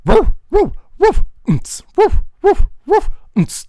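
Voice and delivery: high, loud run of woofs; loud, high-pitched dog-bark percussion; barking beatbox. Shown as a demonstration. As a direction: bark out a busier beat. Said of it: "on to the next part".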